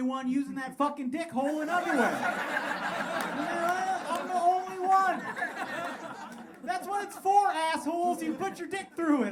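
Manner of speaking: laughing outraged tirade; exasperated rant. Real, not acted. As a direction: insist they're the weirdos. Ranting at the audience.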